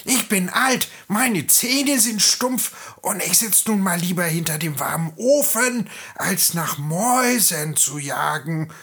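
high-pitched, aggrieved cat voice; grumpy old cat. Acted. Old cat tells how she fled drowning, aggrieved. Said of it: "fauchte die Katze".

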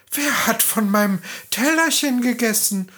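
horrified little dwarf; shocked dwarf cry. A narrator's reading. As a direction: cry out in dismay like a tiny dwarf. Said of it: "Der zweite rief entsetzt".

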